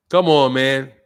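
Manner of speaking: ordinary delivery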